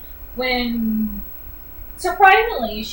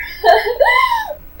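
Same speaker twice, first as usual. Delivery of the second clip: burst of laughter; 6 dB louder than usual. Real, not acted. Laughing at the scene.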